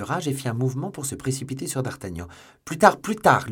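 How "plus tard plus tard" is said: high, loud call; raised, urgent shout put-off. A narrator's reading. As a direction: call it back while hurrying away. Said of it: "lui cria celui-ci".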